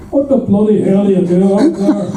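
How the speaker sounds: mock indignant yelp from the man below; indignant outburst. Man hit by falling ash calls up, indignant.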